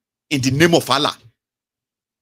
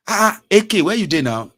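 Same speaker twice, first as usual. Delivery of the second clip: excited friend; 7 semitones higher, 5 dB louder than usual. Retold or imitated. Friend calling, surprised.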